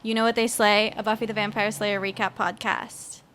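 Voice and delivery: upbeat announcer read of the show intro; podcast intro. Acted. Host opening the recap podcast, upbeat announcer read. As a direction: open the episode like a host.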